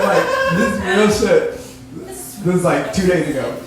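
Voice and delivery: ordinary delivery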